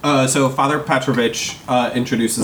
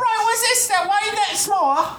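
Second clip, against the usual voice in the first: put-on child voice. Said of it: "he goes".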